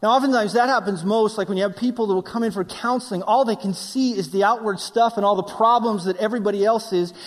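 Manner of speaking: normal talking voice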